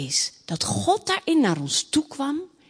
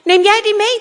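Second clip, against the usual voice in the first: high, loud princess protest; indignant child demanding an answer; cross; 6 semitones higher, 6 dB louder than usual. Acted. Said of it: "de Robijntje boos". (boos, verontwaardigd kind)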